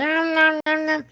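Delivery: mock munching noises, high and soft; CM Punk. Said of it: "C M Punk was like".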